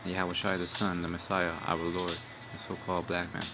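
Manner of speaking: ordinary talk, nothing marked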